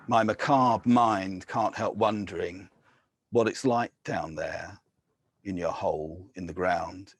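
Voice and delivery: measured, grave poetry reading; poem reading. Acted. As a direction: read it like an elegy at a graveside. Poet reads elegy aloud.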